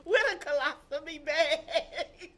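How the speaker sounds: loud, high, slow and drawn out; stretched-out exclamation breaking into laughter; laughing punchline. Real, not acted. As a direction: crack up while delivering the absurd detail. Punchline of gossip, both laughing.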